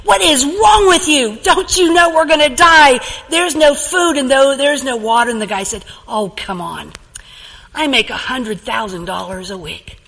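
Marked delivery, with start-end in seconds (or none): 0.0-5.3 loud, high exasperated shout; mock fury at a calm friend; infuriated. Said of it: "just infuriated the first guy". 6.1-10.0 unbothered. Acted. Calm man unbothered.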